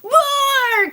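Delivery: very high, loud singsong roar; forte loud. Pretend. Playing Brownie Bear's accent, forte loud roar. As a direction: blast out the bear's roar at full volume. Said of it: "sang with a fancy accent, forte loud".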